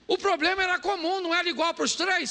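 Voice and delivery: high, ringing pulpit voice with rising urgency; fervent preaching. Acted. Preacher pressing the crowd on shared problems, fervent and ringing. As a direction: drive the point home to the congregation.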